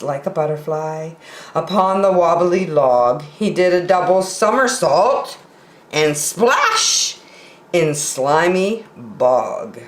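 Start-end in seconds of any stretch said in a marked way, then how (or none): none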